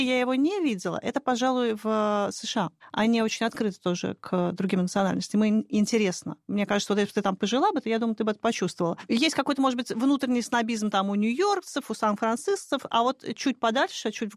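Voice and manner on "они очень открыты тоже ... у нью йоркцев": usual speaking voice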